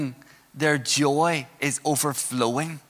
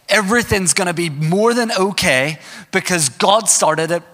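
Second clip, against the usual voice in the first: raised, driving pulpit delivery; emphatic sermon climax, pitched up; impassioned preaching; 4 semitones higher than usual. Real, not acted. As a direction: preach it with building conviction. Preaches at full force.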